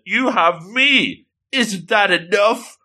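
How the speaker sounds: high, slow mock hurt; hammed-up upset appeal. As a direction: plead as the wounded girlfriend, acted. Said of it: "And then she's upset".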